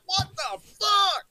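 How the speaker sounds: high, drawn-out mock exasperation; pissed-off outburst. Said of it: "he was so pissed".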